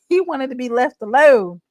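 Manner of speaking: normal talking voice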